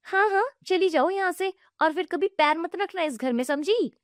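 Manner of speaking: high, harsh dismissal; throwing her out. Acted. Aunt gloating as she throws the widow out, high. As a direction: drive her from the house.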